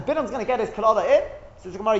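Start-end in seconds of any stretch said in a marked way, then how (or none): none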